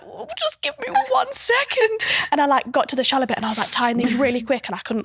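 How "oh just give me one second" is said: very high, drawn-out squeaky plea; strained, sing-song mock politeness; mock flustered stalling. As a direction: stall in a high, hammed-up panic. Retold flustered stalling while naked in pool.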